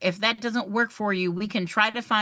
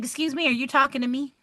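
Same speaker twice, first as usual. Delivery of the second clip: quick, raised mock confrontation; retold stern challenge; 5 semitones higher, faster than usual. Teacher confronting a student who insulted her, quick mock challenge. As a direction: call out the rude student, half-playful. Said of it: "And I was like".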